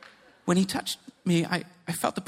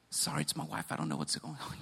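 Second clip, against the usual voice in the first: low, soft embarrassed mumble; embarrassed apology; 4 semitones lower, 9 dB quieter than usual. Retold or imitated. Husband excusing his laughing wife, embarrassed undertone. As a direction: imitate a sheepish aside to onlookers. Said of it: "My brother's getting embarrassed".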